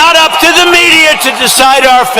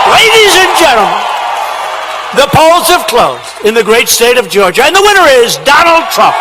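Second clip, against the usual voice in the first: announcer voice. Retold or imitated. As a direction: proclaim the state result like a broadcast host. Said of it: "they said".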